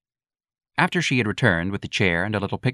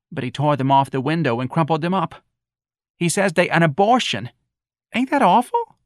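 put-on chatty old mother; 8 semitones higher than usual. Missus Riley telling Santa about the curtains, aghast. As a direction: chatter and complain about your son.

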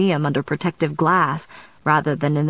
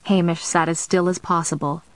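plain reading voice

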